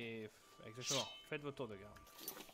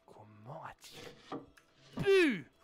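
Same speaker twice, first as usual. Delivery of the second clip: slow loud appalled gasp; hammed-up indignation; mock outrage; 4 semitones higher, 11 dB louder, much slower than usual. Gasp at letter's betrayal.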